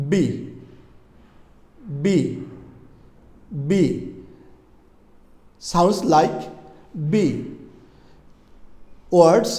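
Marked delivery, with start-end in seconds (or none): 0.1-7.3 "বি" popped from closed lips. Shown as a demonstration. Teacher demonstrates the letter B, isolated and deliberate. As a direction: press the lips, then release "বি", each one set apart. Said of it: "দুই ঠোঁট বন্ধ করে উচ্চারণ করুন".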